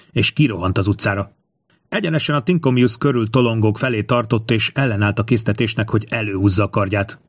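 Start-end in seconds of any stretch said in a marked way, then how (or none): none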